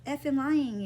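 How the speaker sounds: retold child's loud protest; angry shout. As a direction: snap back as a furious four-year-old. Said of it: "got angry".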